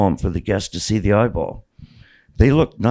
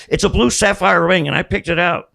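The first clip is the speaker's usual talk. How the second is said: crying out; 8 semitones higher than usual. Retold or imitated. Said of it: "I cried out".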